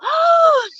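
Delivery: sharp high gasp at the dentist. Retold or imitated. Comic recalling her hot dentist, high gasp. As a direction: gasp like you're starstruck.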